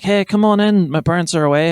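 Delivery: put-on high, inviting kid voice; wee girl. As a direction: imitate a young girl calling out an invitation. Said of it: "And they were like".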